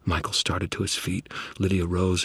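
ordinary delivery

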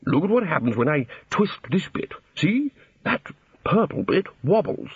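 lightly muffled lecturer's voice; as if calling from inside a whale. A narrator's reading. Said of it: "muffled noises from inside the whale".

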